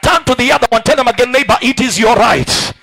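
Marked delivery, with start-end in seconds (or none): none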